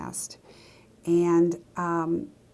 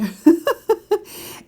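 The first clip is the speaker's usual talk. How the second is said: a high bright laugh at her own no-no; real burst of laughter; 13 semitones higher, 9 dB louder than usual. Real, not acted. Sharing a guilty story with viewers, real laughter. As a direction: laugh out loud at the confession.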